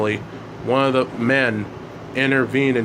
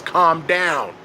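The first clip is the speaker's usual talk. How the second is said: mild raised-pitch yell; understated yell; 4 semitones higher than usual. A narrator's reading. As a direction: fatherly command to stop arguing. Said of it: "the father yells".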